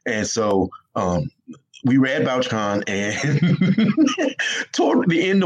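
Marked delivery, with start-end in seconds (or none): 2.9-5.4 laughing. Real, not acted. Laughing while starting the story.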